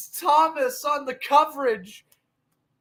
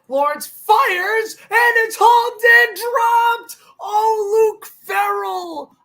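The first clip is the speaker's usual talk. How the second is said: excited shout; 9 semitones higher, 6 dB louder, slower than usual. Real, not acted. Dropped touchdown play-by-play.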